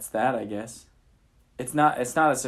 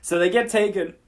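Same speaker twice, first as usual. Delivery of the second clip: higher, louder dramatic take; 8 semitones higher, 5 dB louder than usual. Shown as a demonstration. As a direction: announce it like a grand reveal. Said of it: "less dramatic".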